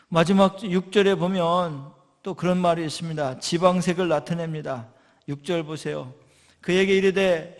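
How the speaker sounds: loud, driving sermon delivery; forceful preaching. Acted. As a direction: preach it out to the congregation. Preaching on Judges passage. (힘 있게 외치는 설교)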